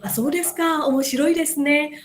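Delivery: bright, interested reply; lively interest. Shown as a demonstration. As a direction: sound genuinely interested. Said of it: "kalau nadanya kayak Mahendra-san tadi". (nada tertarik dan bersemangat)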